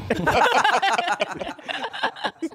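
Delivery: high burst of laughter. Real, not acted. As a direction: let out a big laugh. Laughing after Theo line.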